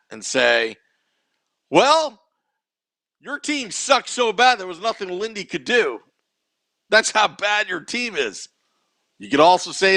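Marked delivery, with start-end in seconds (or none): 1.7-8.5 high, cocky homer retort; mocking rival fan. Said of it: "make a homer comment, Matt, and say".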